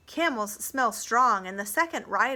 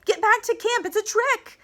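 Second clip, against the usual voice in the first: high, loud alarm cry; yelled warning; 12 semitones higher, 6 dB louder than usual. A narrator's reading. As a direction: shout the alarm urgently. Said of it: "she yells".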